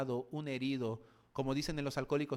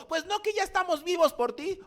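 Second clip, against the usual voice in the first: exasperated Samaritan; 9 dB louder than usual. Acted.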